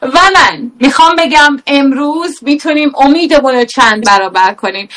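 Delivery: normal talking voice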